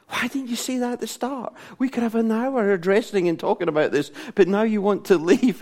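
high-pitched pent-up protest; mock plaintive exasperation; exasperated. Acted exasperated thought at late confession.

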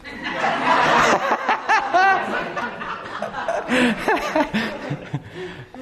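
long, high, helpless laughter. Real, not acted. Preacher cracking up at his friend's refusal.

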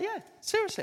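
high emphatic seriously; insist, voice leaping up; earnest insistence. Retold or imitated. Insists he means it.

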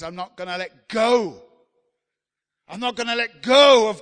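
loud, rising declaration of faith; impassioned preaching. Acted. Preacher voicing a believer's unshaken faith, loud and building. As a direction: declare it like you won't let go.

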